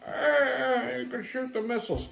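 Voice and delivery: lightly voiced feeble old man; a hint of a confused senile mumble; doddering old man. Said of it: "this fucking demented geriatric goes".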